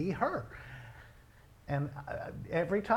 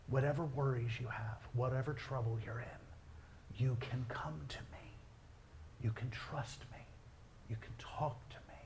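tender hushed reassurance; 3 semitones lower, 7 dB quieter than usual. Retold or imitated. Telling his son he can confide.

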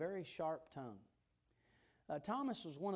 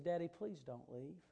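usual speaking voice

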